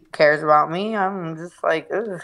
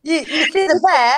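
high, louder mock British; put-on British accent pitched way up; 11 semitones higher, 5 dB louder than usual. Friend trying the British neighbor's question, hammed-up British accent. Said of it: "I can't do the British accent".

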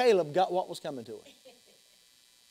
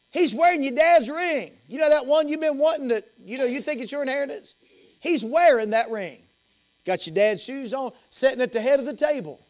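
excited reporting; 6 semitones higher than usual. Acted. Acted servant reports the honors.

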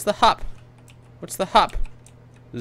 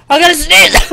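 higher-pitched urgent exclamation; real rising urgency; urgent outcry; 7 semitones higher, 4 dB louder than usual. Real, not acted. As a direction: cry out that a sneeze is coming. Repeats sneeze warning, escalating.

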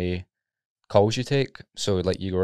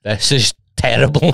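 mock outburst; 5 semitones higher, 10 dB louder than usual. Retold outburst at call centre.

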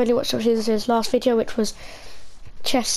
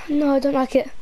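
normal talking voice

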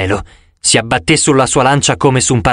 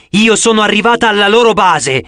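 shouting; 6 semitones higher than usual. A narrator's reading. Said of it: "urlò lei".

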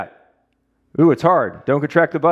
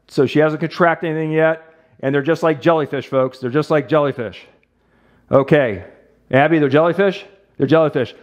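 ordinary talk

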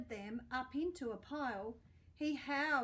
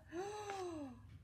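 sharp gasp; 6 semitones higher, 5 dB quieter than usual. Acted. Pig falls out window.

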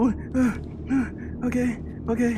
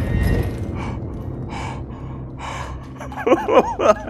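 shrill scared laugh; 4 dB quieter than usual. Real, not acted.

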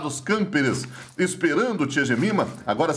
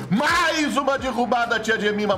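excited call; 6 semitones higher than usual. Real, not acted. Hype call.

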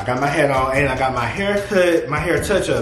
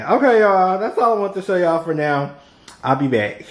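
ordinary talk, nothing marked

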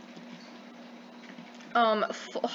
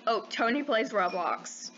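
ordinary talk

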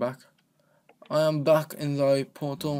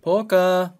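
louder, higher shout of Porker!; calling out; 6 semitones higher, 7 dB louder than usual. Real, not acted. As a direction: call out to find him.

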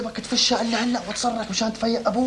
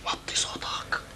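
whispered, softer hush; 7 dB quieter, slower than usual. Acted. Brother hushing his sibling so father won't wake, whisper. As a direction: keep it down, urgently.

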